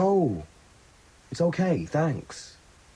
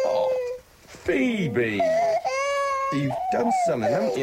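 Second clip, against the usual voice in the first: put-on soothing a child; slower than usual. Cooing at the baby.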